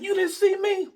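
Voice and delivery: very high, indignant retold question; incredulous challenge. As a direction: demand it in disbelief, voice way up. Acted quarrel with partner.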